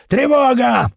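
high, loud drawn-out alarm yell. A narrator's reading. Guard raising the alarm, high loud yell. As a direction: bellow the alarm across the building. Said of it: "продолжал орать тот крендель".

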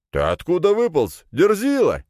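high, forceful demand; hostile challenge. A narrator's reading. Mage to hero who insulted him, hostile. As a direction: confront an insolent upstart.

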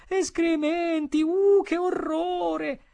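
very high, drawn-out squeal; horrified diner. A narrator's reading. Diner horrified at the dish's contents, squealing. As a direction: squeal it slowly in horror.

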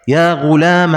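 "غلام" said ghulaama, short last a; final fatha. Shown as a demonstration. Teacher showing the fifth form, "غلام" said ghulaama. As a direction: add a brief a after "غلام". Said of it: "حذف الألف والاجتزاء بالفتحة".

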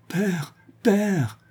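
crier bien plus aigu, désespérée; appel désespéré. A narrator's reading. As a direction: appeler son père avec désespoir. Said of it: "appela Véronique avec désespoir". (cri désespéré, voix bien plus haute)

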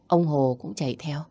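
ordinary delivery, nothing marked

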